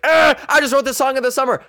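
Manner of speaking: high, hammed-up hype voice; mocked TikTok promoter. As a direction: squeal it like a self-promoting musician. Mocking self-promoting TikTok musician.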